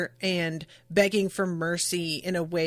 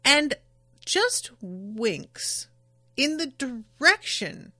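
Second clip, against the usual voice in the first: ordinary delivery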